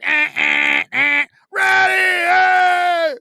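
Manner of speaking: high, loud hollering at a passing celebrity; fans yelling from cars. Retold or imitated. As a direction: shout up high like a fan from a car window. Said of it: "They're beeping".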